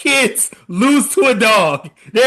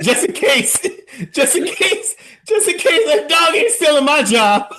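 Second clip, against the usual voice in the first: laughing; 6 semitones higher than usual. Retold or imitated. Mock suspicious customer.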